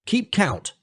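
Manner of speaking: high, scornful call; mock the villagers as he leaves; scornful shout. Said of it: "shouted Mowgli scornfully".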